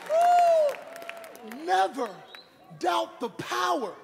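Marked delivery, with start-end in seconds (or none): 0.1-1.6 piercing church whoop; high praise whoop. Real, not acted. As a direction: let out a joyful woo. Praise break cry.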